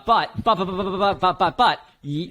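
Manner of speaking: can't get the words out fast enough; excited stammer. Real, not acted. Host bursting to share car news, excited stammer.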